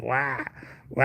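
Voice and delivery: high, whiny mock wail of a cat; imitated cat yell. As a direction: mimic a lazy cat yelling for company. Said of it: "he just yells at me".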